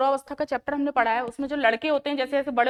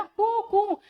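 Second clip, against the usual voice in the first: cuckoo call; 7 semitones higher, much slower than usual. Shown as a demonstration. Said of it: "कोयल की आवाज".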